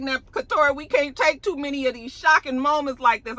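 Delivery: high, exasperated plea; mock plea. Viewer pleading with Katori over too many shocks, high and exasperated. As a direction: beg the writer for mercy. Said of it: "I was like".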